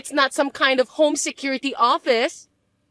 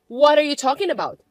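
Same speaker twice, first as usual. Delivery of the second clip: usual speaking voice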